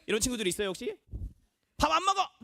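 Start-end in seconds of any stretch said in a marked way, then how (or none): 1.8-2.3 high-pitched stubborn refusal; food tantrum. Acted. Picky eater refusing a meal, high tantrum. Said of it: "반찬 투정하는 사람".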